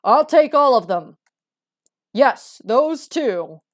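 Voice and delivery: loud, slow, grand orders; a vain noble buying out the whole stall; haughty rich pheasant. Acted. Pheasant buys every bean bin, slow and loud.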